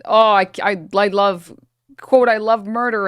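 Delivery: hammed-up gleeful villain line, pushed loud; mock Hassan quote. Streamer mocking Hassan's quote, a loud hammed-up confession. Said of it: "And said, uh".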